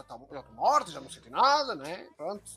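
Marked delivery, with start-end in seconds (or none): none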